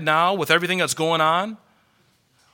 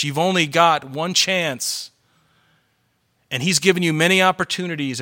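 usual speaking voice

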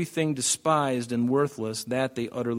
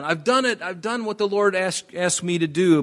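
much higher, proud and eager; eager insistence; 7 semitones higher, 3 dB louder than usual. Acted. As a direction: Saul overselling his obedience. Saul insisting he obeyed.

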